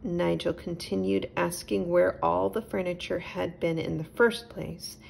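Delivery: ordinary delivery, nothing marked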